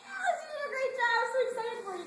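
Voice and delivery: high, loud, gushing praise. Retold or imitated. Retelling leaders praising a member, high and gushing. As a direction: cheer them on like a proud leader. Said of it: "they're like".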